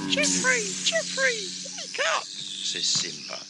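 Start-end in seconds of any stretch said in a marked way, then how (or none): 0.1-1.4 very high calling voice; very high rising Geoffrey Geoffrey; waking call. Acted. Simba wakes Geoffrey. 1.8-2.2 very high voice; very high wake up. Acted. Simba wakes Geoffrey.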